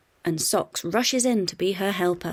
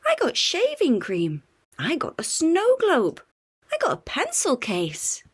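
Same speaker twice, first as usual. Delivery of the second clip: put-on excited child; 9 semitones higher, 4 dB louder than usual. A narrator's reading. Kids showing presents.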